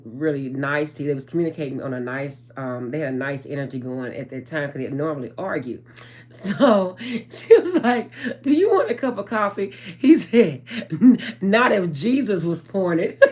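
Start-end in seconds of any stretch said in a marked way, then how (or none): 6.3-13.2 high, loud retelling through real laughter; laughing while talking. Real, not acted. Vlogger retelling a coffee scene that tickles her, laughing. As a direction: crack up while quoting the line. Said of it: "I couldn't help but laugh".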